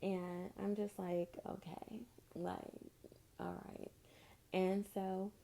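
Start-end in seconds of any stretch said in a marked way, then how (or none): 1.5-3.9 much softer, almost whispered okay; an uneasy hushed reply; awkward hushed okay. Retold or imitated. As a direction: back off with a near-silent all right. Awkward response to his antics.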